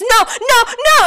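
high, loud repeated cries; mock horror. Mom watching the deer attacked, mock horror. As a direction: imitate horrified alarm, pitch shooting up.

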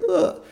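short, loud mock groan; exasperated groan. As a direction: groan in retold dismay. Retold groan over low bookings.

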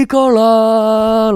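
long, high, drawn-out commercial call; imitated yell. Kid brother yelling into the void, long high call. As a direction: stretch it out like the advert's yodel. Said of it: "my brother just yells out".

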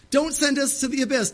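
high desperate demon plea; high-pitched pleading demon voice; begging demon. Acted. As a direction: beg not to be cast out. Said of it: "how the demons were begging Jesus".

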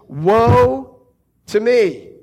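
loud anguished cry of woe. Acted. Prophet crying out in the very presence of God, anguished and loud. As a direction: cry it out like a man undone. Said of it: "He cried out".

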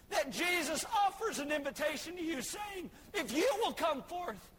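ordinary talk

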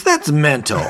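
high, loud incredulous retort; imitating an exasperated boss; mock outrage. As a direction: play up the disbelief. Boss outraged at the show of hands.

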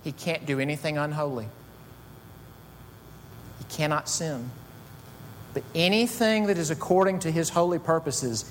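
normal talking voice

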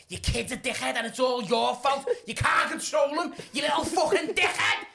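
high, loud angry rant; screaming at her. Acted. Man blaming a mother for her kid, shouting. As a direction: shout the blame, climbing in pitch. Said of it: "I'm screaming at her".

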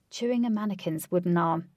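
ordinary delivery, nothing marked

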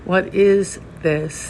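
very slow, weighted question, each word held; slow, contemplative inquiry; slow koan. Shown as a demonstration. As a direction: pose it as a koan, unhurried. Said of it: "primary and beautiful Zen koan".

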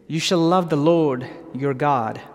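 ordinary delivery

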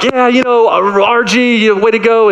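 put-on high, bright praise for a toddler; cheering dad. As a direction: cheer her on like she won a prize.

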